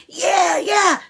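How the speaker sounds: high, shouted yeah yeah like a hyped mom; imitating a cheering mom; cheering sports mom. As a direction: cheer it like a rowdy sideline mom. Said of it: "Some other moms may be like".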